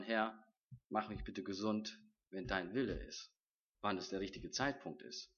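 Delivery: a soft, humble plea to the Lord; humble quiet prayer. Retold or imitated. Preacher models the prayer he should have prayed, softly and humbly. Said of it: "hätte ich einfach mal beten sollen".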